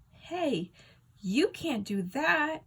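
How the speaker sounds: high, loud protesting shout. A narrator's reading. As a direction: yell it high to stop her. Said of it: "I shout".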